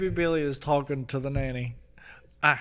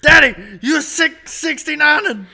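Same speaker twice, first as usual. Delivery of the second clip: high, loud shocked yelling; shocked kids shouting; 12 semitones higher, 11 dB louder than usual. Retold or imitated. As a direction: shriek it like horrified children. Quoting kids shouting at dad.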